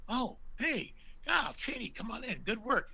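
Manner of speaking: high, easygoing put-on welcome; casting guy. Casting man welcoming Chaney in, high put-on voice. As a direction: wave him in like a casual studio clerk. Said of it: "And he goes".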